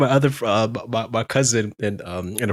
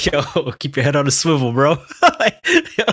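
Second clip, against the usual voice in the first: higher-pitched, laughing retelling of friends' warning; 6 semitones higher, 5 dB louder than usual. Retold or imitated. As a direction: crack up while voicing the friends.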